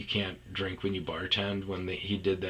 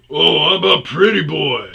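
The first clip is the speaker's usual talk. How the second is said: higher, much louder mocking tease; 8 semitones higher, 14 dB louder than usual. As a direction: sneer it like a heckler. Customers mocking, loud.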